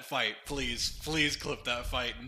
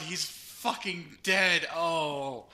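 ordinary talk, nothing marked

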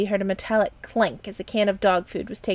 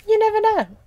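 much higher, louder jolly quip; jolly captain; 13 semitones higher, 8 dB louder than usual. A narrator's reading. The captain joking about dog food, high loud and jolly. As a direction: toss it off like a cheerful old sea dog.

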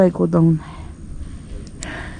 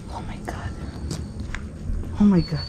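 1 scared whisper; 10 dB quieter, much faster than usual. Real, not acted.

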